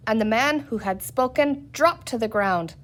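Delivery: usual speaking voice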